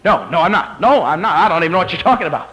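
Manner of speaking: flustered denial. Acted. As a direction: deny it in a rush. Peter denies the girl's accusation.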